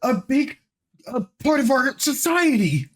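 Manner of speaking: high, insistent put-on voice; high-pitched mock urgency. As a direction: pitch it way up and declare it to everyone. Acting out people's dramatic realisation.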